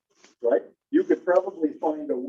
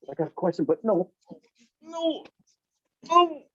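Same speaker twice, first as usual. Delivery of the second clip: hesitant mock student, high no no; 8 semitones higher than usual. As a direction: play the unsure student, squeaky on the last no's. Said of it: "you're going".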